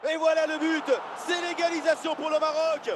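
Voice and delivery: high joyful goal call; excited sports commentary. Acted. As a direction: celebrate the equaliser. Morocco equalises. (annonce de but enthousiaste et aiguë)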